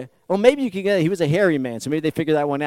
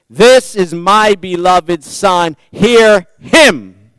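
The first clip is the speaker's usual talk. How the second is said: slow, loud, booming pronouncement; voice from the cloud; 2 semitones higher, 16 dB louder, much slower than usual. Acted. God speaking from the cloud to the disciples, slow and booming. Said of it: "the voice from the cloud says".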